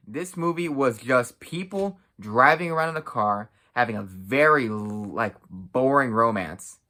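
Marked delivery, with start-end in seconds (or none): none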